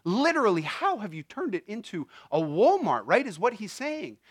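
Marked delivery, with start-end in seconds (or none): none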